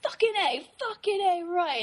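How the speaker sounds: high, hammy manly shout; fake macho cheer. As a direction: force it like a fake jock. Continuing the fake macho act.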